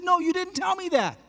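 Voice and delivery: very high amazed insistence; incredulous. Retold or imitated. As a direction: stress NO in delighted shock.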